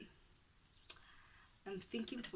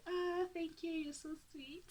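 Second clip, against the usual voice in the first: sweet reply; 8 semitones higher, 5 dB quieter than usual. Retold or imitated. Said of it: "I said".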